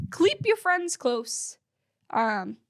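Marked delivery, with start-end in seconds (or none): 0.1-1.6 slow, high "cleep" with an extra L; botched keep. Retold or imitated. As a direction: imitate someone fumbling keep as "cleep", drawn out. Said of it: "He keeps saying".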